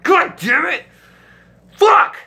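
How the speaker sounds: put-on teen busted by the janitor, swearing out loud; frustrated yell. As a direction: yell it, furious at the interruption. Caught by the janitor mid-hookup.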